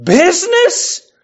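high, drawn-out cry of disbelief; crying ghost. Acted. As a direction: cry it out like a phantom. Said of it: "cried the ghost".

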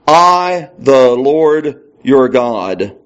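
"I, the Lord your God" drawn out very slowly; weight on each word of "I, the Lord your God"; word-by-word emphasis. Shown as a demonstration. Singles out five words for emphasis.

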